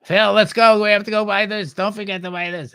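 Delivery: high, loud obnoxious wife, easing off at the end; grating woman voice. Retold or imitated. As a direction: nag your husband in an obnoxious high voice. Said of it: "grating, right?".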